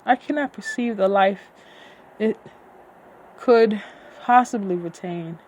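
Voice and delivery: grand fantasy narration; dramatic story reading. Acted. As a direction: read it like an epic, full voice. Reads her book aloud.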